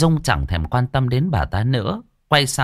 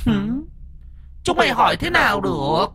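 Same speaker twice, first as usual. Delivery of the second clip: high, shrill spirit sneer; a ghost scoffing at mortals; eerie ghost voice; 14 semitones higher than usual. Acted. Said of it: "giọng ma quái ghê rợn".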